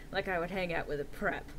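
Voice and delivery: usual speaking voice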